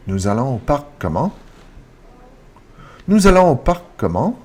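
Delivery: "comment" lifted at the end; rising intonation. Shown as a demonstration. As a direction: turn the statement into a question by raising "comment". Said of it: "It's a rising intonation".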